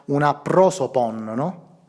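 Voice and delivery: usual speaking voice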